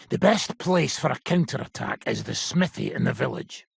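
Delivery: normal talking voice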